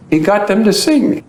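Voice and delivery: ordinary talk, nothing marked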